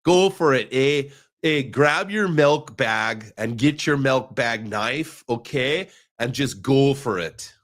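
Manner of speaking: hammed-up Canadian, slow and drawn out; leisurely mock-Canadian encouragement; Canadian accent. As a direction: put on a jokey Canadian voice. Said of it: "I don't know how it went Canadian".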